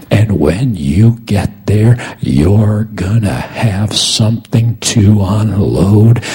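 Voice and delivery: high, loud, stretched-out declaration; full-force preaching. Real, not acted. Preacher declares to congregation they will finish their race, full-force preaching. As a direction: proclaim it slowly and powerfully over the congregation.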